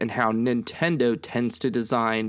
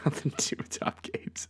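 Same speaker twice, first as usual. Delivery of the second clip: whispered laugh; 3 semitones higher, 9 dB quieter than usual. Real, not acted. Laughing aside after teasing.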